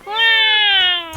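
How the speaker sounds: loud amazed wow. Real, not acted. Exclaims at a pun.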